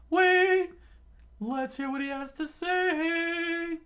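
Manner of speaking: very high, loud commanding cry; high cartoon voice. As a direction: halt him with a high shout. Sanji stops Luffy.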